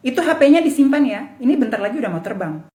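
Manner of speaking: ordinary delivery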